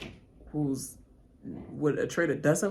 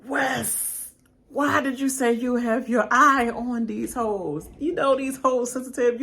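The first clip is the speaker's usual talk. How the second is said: exasperated scolding; 7 semitones higher, 6 dB louder than usual. Acted. Scolding Wes directly.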